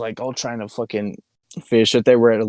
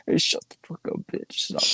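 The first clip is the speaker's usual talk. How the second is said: whisper; 5 semitones higher, 7 dB quieter than usual. Real, not acted. Hushing friend mid-laugh.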